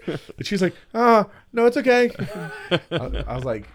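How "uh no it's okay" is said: high, louder mock-panicky reassurance; imitating a terrified passenger. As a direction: pretend it's fine in a squeaky, nervous voice. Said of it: "She was terrified".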